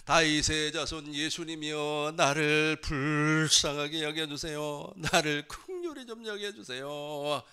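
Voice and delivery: acted desperate shout to Jesus; desperate cry. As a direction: cry out urgently for mercy. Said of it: "절박한 심정 내어보세요".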